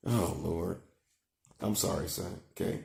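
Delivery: low, soft and contrite; hushed apology. Real, not acted. Father sorry for cussing before son, low and hushed. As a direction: sink into a quiet, sorry murmur.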